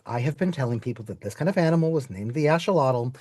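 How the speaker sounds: ordinary talk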